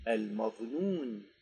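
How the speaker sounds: ordinary talk, nothing marked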